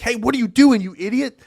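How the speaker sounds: high, loud mock telling-off; angry boss. Boss on a phone call to an understudy, hammed-up angry scolding. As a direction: act out a boss berating you. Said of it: "you get a phone call from your boss".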